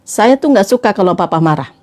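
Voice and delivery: low, serious word to husband; earnest wife. Acted. Acts wife addressing husband. (istri menegur serius dengan nada rendah)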